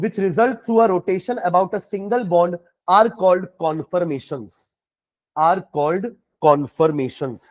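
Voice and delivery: very slow, measured dictation; word by word, pausing for writers; dictating. Real, not acted. Teacher dictates a definition for students to write, slowly and deliberately. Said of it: "चलो definition लिखना".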